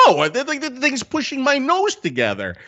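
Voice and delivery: high-pitched protest, retold; distressed complaint. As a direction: play up the squeezed-nose grievance. Retells complaint about mask squeezing nose.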